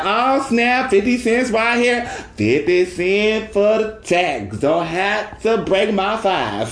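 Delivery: high, slow, overjoyed; thrilled. Retold or imitated. At the drive-thru, thrilled to keep the five, high delight. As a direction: cheer over spare change. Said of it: "Then I said".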